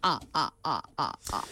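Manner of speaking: low, spaced-out cartoon vampire laugh; the Count. Suggesting a laugh to the co-host, the Count's low staccato laugh. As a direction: count out each "ah" as a deep laugh. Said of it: "the Count from the Sesame Street".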